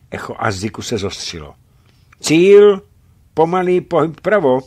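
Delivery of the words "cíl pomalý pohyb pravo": high, loud report shout; called out. A narrator's reading. Lockhart reports the target from the set, loud call. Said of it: "zvolal Lockhart".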